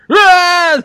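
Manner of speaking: loud, very high "aah!"; hammed-up death scream; mock falling scream. Voicing guys falling off the log, loud high mock scream. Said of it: "one guy's going".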